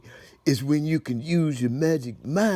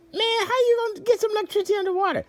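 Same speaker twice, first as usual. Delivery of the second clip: incredulous squeak; much faster than usual. Acted.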